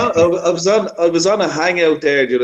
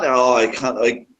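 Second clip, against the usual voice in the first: ordinary delivery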